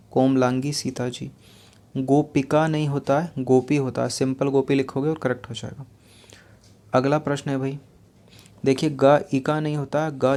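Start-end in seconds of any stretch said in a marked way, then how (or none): none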